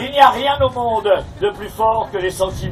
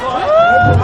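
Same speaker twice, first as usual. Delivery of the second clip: angry shout; 7 dB louder than usual. Acted. Puppeteer threatens a heckler.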